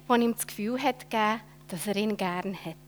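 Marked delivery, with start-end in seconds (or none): none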